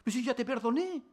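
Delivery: God's voice, puzzled, with one high jump on "¿De qué me hablas?"; a mildly baffled God who has already forgotten; surprised God. Pretend. God answering the believer, gently surprised. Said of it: "Dios pregunta".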